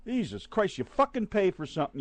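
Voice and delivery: normal talking voice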